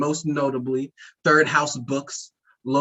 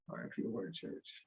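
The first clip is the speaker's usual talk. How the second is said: whisper; 21 dB quieter than usual. Pretend. Mourning joke, whispered aside.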